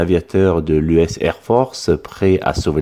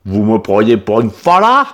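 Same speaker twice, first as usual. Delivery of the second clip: put-on accent du Midi vexé et plus fort; le paysan se sent insulté; indigné; 2 semitones higher, 5 dB louder than usual. A narrator's reading. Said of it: "s'indigna-t-il". (accent méridional indigné)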